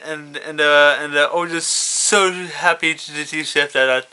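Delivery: ordinary delivery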